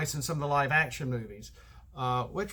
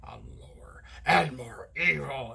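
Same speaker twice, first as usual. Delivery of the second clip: sinking into a growly villain whisper; mock over-dramatized evil, dropping lower; evil deep voice; 3 semitones lower than usual. Shown as a demonstration. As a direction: drop down step by step into menace. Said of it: "trying to make his voice lower and lower".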